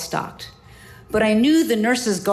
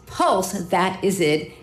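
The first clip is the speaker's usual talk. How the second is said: slow relief; much slower than usual. Retold or imitated. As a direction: draw out "pulse, that is it" slowly. Confirming the idea.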